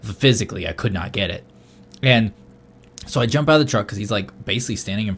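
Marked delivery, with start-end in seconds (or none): none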